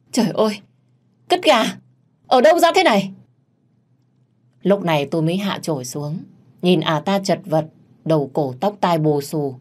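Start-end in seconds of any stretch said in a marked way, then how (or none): 0.1-3.1 high, loud scream of disgust; horrified shriek. A narrator's reading. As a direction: shriek it out, pitch leaping up. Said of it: "ả ta mới hét toáng lên". (hét toáng lên)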